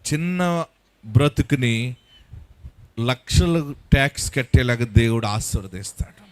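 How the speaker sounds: low, soft pastoral voice over music; tender, subdued sermon voice; hushed preaching. Acted. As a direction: speak gently into their grief.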